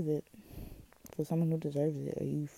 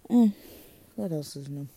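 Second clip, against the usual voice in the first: ordinary delivery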